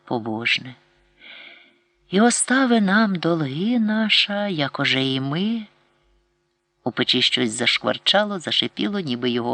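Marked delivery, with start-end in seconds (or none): 2.1-5.7 slow, higher, prayer chant; mother's tender pious prayer; gentle pious prayer. A narrator's reading. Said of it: "лагідне, побожне".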